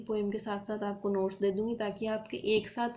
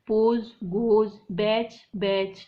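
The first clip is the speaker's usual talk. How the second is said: पोज, गोज said louder and very slowly, endings stretched; slow rhyme callout; 6 dB louder, much slower than usual. Shown as a demonstration. Teacher showing rhyme pairs, slow and loud. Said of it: "वही हो जाएगा जैसे".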